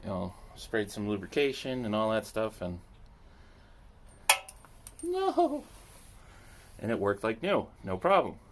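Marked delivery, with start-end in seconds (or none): 5.0-5.6 high squeaky no; dramatic no. Acted. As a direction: squeal a mock no. Mock dramatic no.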